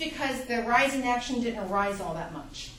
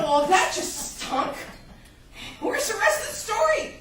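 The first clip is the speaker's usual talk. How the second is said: complain, then protest in a much higher voice; indignant let-down reader; 9 semitones higher, 3 dB louder than usual. Acted. Let-down reader reacting to bad ending.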